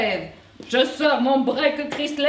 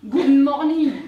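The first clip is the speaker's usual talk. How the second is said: mocking English greeting; 4 dB louder than usual. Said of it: "vous nous dites".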